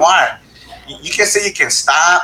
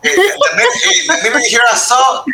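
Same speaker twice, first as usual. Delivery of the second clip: laughing; 12 semitones higher than usual. Real, not acted. Laughing at Spanglish slip.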